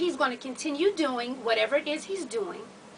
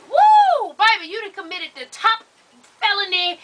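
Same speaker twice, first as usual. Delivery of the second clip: high, loud mock whoop; hammed-up whoop of outrage; mock outraged whoop; 4 semitones higher, 5 dB louder than usual. Mocking man's overreaction.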